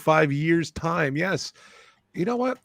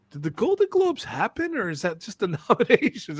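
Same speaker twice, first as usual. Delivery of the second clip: puzzled question; 6 semitones higher than usual. Retold or imitated. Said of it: "I'm like".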